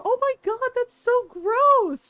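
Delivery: high-pitched, appalled stepmom; acted-out disgust. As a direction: stretch it out in grossed-out dismay.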